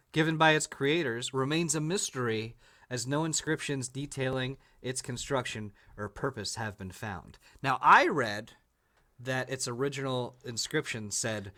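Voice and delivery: ordinary talk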